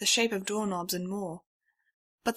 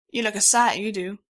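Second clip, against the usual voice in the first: louder folksy Southwest stallion; the stallion fusses over the stranger in a twang; Southwestern twang; 7 dB louder than usual. A narrator's reading.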